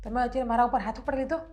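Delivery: soft, low wounded voice; wife struck by husband, softly wounded; hurt and tearful. Acted. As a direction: speak through the hurt of being hit. Slapped wife speaks hurt.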